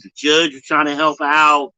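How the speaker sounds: ordinary talk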